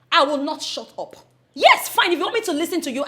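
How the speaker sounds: ordinary talk, nothing marked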